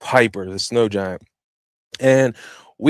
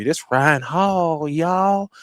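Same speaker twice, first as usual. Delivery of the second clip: slow, drawn-out Southern "Ryan Hall, y'all"; Southern twang shout-out; 5 semitones higher, much slower than usual. Retold or imitated. Praising the weatherman in his Southern catchphrase. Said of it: "He got this little Southern twang".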